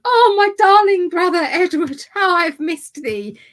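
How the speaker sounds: put-on high, loud, slow, gushing old-fashioned lady; swooning royal sister. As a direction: ham up a doting medieval queen. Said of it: "Elizabeth of York would have gone".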